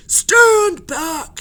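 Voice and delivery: high, very loud order; commanding shout. Acted. Captain shouts at the crowd, high and very loud. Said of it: "he shouted".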